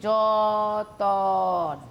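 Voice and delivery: যতন drawn out very slowly, syllable by syllable, জ-ত-ন; split apart slowly. Shown as a demonstration. Teacher to students, drawing যতন out very slowly. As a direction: stretch যতন out slowly to show the vowel inserted. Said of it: "কথাটাকে ভাগ করছি".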